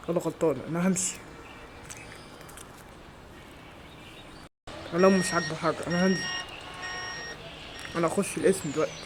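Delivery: quiet, wounded sulk; sulky grumbling. Acted. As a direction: complain under your breath after a telling-off. Sulking after being yelled at.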